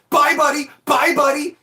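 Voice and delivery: high, loud, sing-song repeated taunt; mocking farewell yelled again and again; taunting goodbye. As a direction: needle him with every bye. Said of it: "Young Mike was just like".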